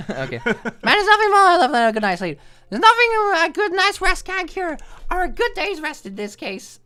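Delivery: perky heroine voice. Acted. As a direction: gush like a carefree cartoon heroine. Voices the heroine reading aloud.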